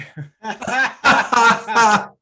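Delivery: loud, high burst of laughter; big punchline laugh. Real, not acted. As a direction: crack up hard at the joke.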